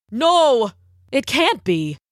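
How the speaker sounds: horrified storybook prince; shocked prince. A narrator's reading. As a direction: exclaim in acted dismay. Prince finds a trap.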